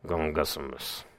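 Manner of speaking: "Gungosimus" said low and rumbling; rumbling word. Shown as a demonstration. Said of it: "It sounds kinda like gungosimus".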